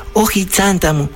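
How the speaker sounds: high woman's wail of loss; mock outcry. A narrator's reading. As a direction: cry out high as the robbed woman. Robbed woman's outcry repeated in story.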